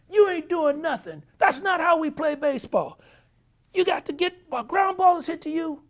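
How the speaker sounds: high, loud exasperated manager; high, angry manager voice. Retold or imitated. Manager berating his players over the book, high and loud. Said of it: "he says".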